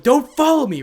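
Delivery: high, loud outburst; sudden yell. Acted. Flustered girl rejecting Issei's company, sudden yell. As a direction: snap into a yell to drive him off. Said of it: "at her sudden yell".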